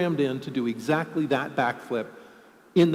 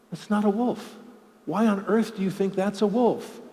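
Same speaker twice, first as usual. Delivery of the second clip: higher-pitched, incredulous scolding; mock exasperation; 6 semitones higher than usual. Trainer to the computer that mislabeled a husky, exasperated. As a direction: question it in baffled disbelief.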